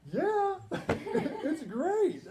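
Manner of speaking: high, loud mock delight with a laugh; sarcastic. Speaker mocking the teacher, high laughing sarcasm.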